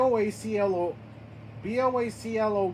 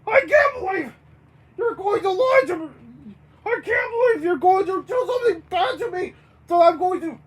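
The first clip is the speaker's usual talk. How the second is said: high, loud furious yell; 7 semitones higher, 6 dB louder than usual. Acted. Bert yells at the baroness over a lie, furious yelling. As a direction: yell high and hard in outrage. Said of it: "Bert yells to Baroness von Von Bon".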